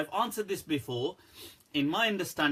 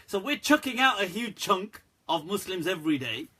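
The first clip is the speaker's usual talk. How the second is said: ordinary delivery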